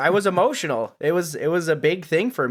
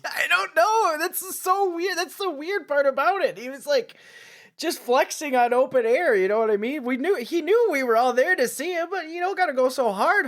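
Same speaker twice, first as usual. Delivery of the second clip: laughing; 11 semitones higher than usual. Real, not acted. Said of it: "laughing high voice".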